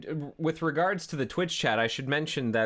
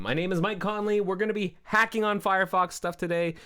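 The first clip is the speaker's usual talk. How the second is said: show host intro; 9 semitones higher, 4 dB louder than usual. Acted. Energetic show opening.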